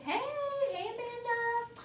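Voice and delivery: high, drawn-out singsong greeting; friendly call-out. Real, not acted. Live seller greeting a viewer who joins, singsong hello. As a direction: sing out the hello, stretched and high.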